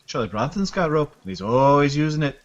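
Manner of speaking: loud, high bad Irish accent. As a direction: ham up a shaky Irish brogue. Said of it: "my very shitty Irish accent".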